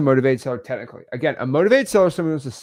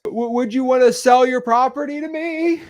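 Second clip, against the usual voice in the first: high, stammering, louder nervous ask; 10 semitones higher, 4 dB louder than usual. Retold or imitated. New wholesaler asking a seller, nervous high stammer. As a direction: stumble out the question like a scared beginner. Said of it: "they sound like this".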